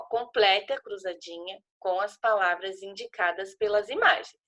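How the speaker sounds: normal talking voice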